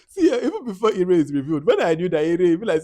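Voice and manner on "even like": ordinary talk, nothing marked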